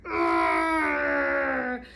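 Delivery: long rough "arr" growl; drawn-out storybook growl; bear growl. Acted. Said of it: "He growls and he grumbles".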